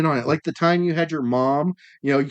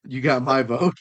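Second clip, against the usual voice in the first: ordinary talk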